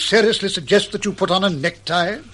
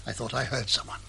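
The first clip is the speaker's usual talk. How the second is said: hushed; 7 semitones lower, 13 dB quieter than usual. Acted. Doctor warning his secretary of a noise, hushed. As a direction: lower and much softer, hushed. Hearing someone outside.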